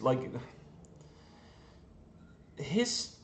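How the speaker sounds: ordinary talk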